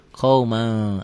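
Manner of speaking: "qauma" ending in a held ng; N read as ng. Shown as a demonstration. Teacher shows N with G, "qauma" ending in ng. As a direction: hum the end of "qauma" at the back of the mouth. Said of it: "N-nya ada G-nya".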